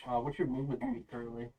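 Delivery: ordinary talk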